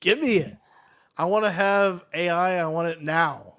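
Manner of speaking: ordinary talk, nothing marked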